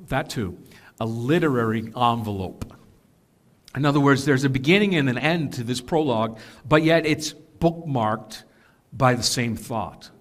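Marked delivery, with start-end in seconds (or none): none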